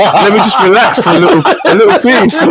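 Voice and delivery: half-laughing daydream. Retold or imitated. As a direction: giggle while mocking own hopes. Laughing mock hope of hotel.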